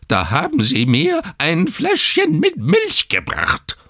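put-on the old newborn outraged about milk; shrill with outrage. A narrator's reading. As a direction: rise into a shrill, outraged squeal. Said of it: "seine Stimme immer schriller".

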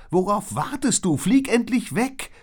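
very high, loud, impatient cry; urgent shout. A narrator's reading. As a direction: yell at her to fly off. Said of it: "rief Liz". (ungeduldig und laut gerufen)

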